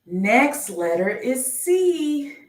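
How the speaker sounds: slow sing-song reveal of the next letter; drawn-out announcement. Acted. Slow game-show style letter reveal.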